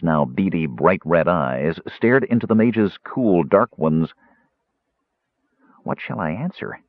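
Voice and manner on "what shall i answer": anxious inner thought. A narrator's reading. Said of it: "Tass wondered frantically".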